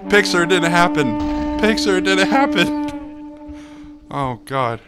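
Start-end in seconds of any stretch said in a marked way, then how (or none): 0.1-1.2 loud, high, triumphant call; excited crowing. Real, not acted. Gamer celebrating getting the picture, loud excited crowing. 1.6-3.5 very high, loud, slow squeal; excited squeal. Real, not acted. Gamer celebrating getting the picture, drawn-out high squeal.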